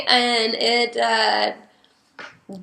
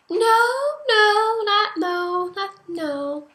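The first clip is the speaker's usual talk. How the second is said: playful sing-song denial; 10 semitones higher, much slower than usual. Retold or imitated. Mom denying she met a guy.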